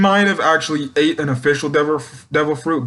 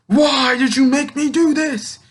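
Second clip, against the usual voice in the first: high anguished cry; 13 semitones higher than usual. Retold or imitated. Orochi wailing at Komurasaki, high anguished cry. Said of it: "he's like".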